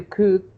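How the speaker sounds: COULD said without any L, like good; silent L. Shown as a demonstration. Teacher repeating the student's sentence, COULD with silent L. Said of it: "you don't pronounce the L".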